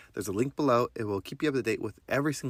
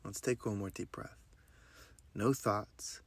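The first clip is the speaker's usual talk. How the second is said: guided meditation; 2 semitones lower than usual. Acted. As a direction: guide them through breath and affirmations gently. Leads a breathing meditation.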